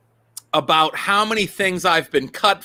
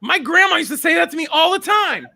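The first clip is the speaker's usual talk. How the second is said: aunt's voice; 10 semitones higher than usual. Retold or imitated. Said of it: "Somebody's aunt was like".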